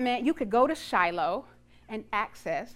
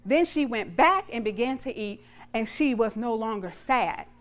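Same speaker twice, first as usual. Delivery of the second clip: ordinary talk, nothing marked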